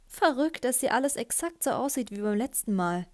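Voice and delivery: usual speaking voice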